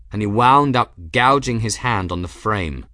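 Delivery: ordinary delivery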